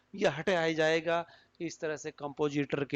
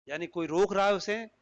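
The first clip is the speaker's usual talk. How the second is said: ordinary delivery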